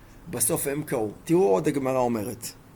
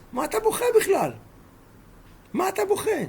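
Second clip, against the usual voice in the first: much higher, pleading disbelief on בוכה; incredulous challenge. Retold or imitated. Rabban Shimon urging the weeping Rabbi Yishmael, much higher, incredulous. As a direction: press him: why on earth are you crying.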